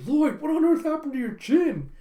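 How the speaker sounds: high, loud alarmed cry; shocked question. Acted. Yor noticing Lloyd's chin, high shocked cry. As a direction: gasp at the bruise.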